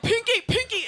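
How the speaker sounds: panicked owner calling his pet; calling the cat. Retold or imitated. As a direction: plead with the cat by name, anxious. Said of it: "he's just like".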